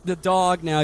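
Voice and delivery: ordinary talk, nothing marked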